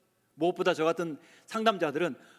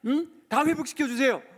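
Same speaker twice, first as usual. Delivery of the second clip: bold demand; 5 semitones higher than usual. Shown as a demonstration. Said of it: "얼마나 담대하게".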